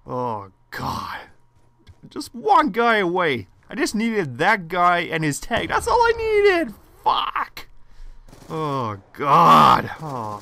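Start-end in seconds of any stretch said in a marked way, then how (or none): 2.1-7.7 frustrated whine. Real, not acted. One kill short, frustrated.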